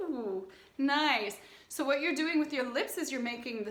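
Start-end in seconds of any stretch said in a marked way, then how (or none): none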